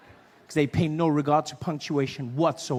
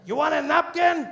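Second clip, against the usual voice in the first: put-on high, louder Mexican-accented repeat; 9 semitones higher, 3 dB louder than usual. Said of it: "He's like".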